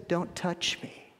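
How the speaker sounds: hushed, slow whisper of Don't touch me; whispered quote. Acted. Jesus quote repeated.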